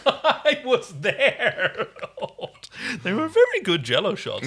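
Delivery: breaking into laughter while recalling; laughing. Real, not acted. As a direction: giggle it out at the shared memory. Friend recalls shared party.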